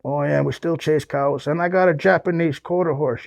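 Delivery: usual speaking voice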